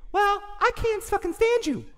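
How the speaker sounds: very high falsetto comeback; hammed-up falsetto quarreler; bickering partner. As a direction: squeak it back in a put-on high voice. Acting both sides of a quarrel.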